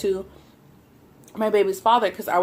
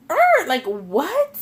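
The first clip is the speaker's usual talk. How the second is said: baffled what; 7 semitones higher, much slower than usual. Retold or imitated. Baffled at the news from a neighbor.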